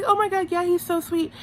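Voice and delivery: high-pitched mock gushing; Teresa. Recapper mimicking a contestant agreeing he's sweet, high gush.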